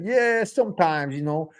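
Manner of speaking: attempted New York accent, badly faked. Shown as a demonstration. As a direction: ham up a shaky-quality fake New York accent. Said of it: "I do New York accent".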